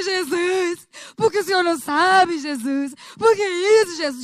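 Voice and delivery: loud tearful pleading; crying prayer. Retold or imitated. Telling Jesus her troubles, loud tearful pleading. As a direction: pour out the complaint through tears. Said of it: "chorando, chorando, chorando".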